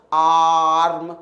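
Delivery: "आर्म" held long on a low "aa"; drawn-out long vowel. Shown as a demonstration. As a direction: stretch the "aa" in "आर्म" for students to repeat. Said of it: "हम word को खींच रहे हैं". ("आर्म" का "आ" खींचकर)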